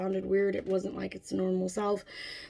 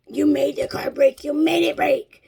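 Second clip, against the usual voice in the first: loud, pitched-up blaming wail; child's tantrum; 5 semitones higher, 7 dB louder than usual. Retold or imitated. Nico blames mom for the broken car, tantrum. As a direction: imitate a kid yelling blame. Imitates son's tantrum accusation.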